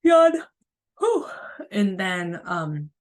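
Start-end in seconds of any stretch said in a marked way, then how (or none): 0.0-0.3 a big, loud, high stretching yawn; an exaggerated demo yawn; demonstrated yawn. Shown as a demonstration. 1.0-1.3 relieved whew. Shown as a demonstration.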